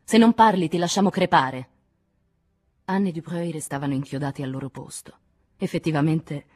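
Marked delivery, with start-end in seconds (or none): 0.0-1.7 loud, high and menacing; fierce raised voice; violent threat. A narrator's reading. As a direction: burst out with an ultimatum. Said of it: "disse Nadine con violenza".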